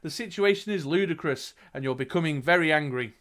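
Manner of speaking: dramatic storyteller, key words punched; game master narrating the action; gamebook narration. A narrator's reading. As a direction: read it out like an adventure tale. Reads gamebook aloud to player.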